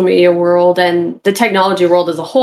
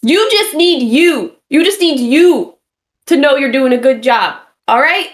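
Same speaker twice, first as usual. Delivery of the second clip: drill sergeant; 7 semitones higher than usual. Acted. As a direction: bark it like a tough coach. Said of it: "I'm like a drill sergeant".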